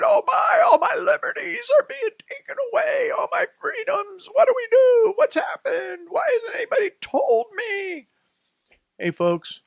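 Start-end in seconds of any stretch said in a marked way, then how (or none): 0.0-8.1 shrill, very high mocking wail of panic; sarcastic imitation of frightened citizens wailing. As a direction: mimic hysterical people crying out in alarm. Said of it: "their hair is on fire because they're saying".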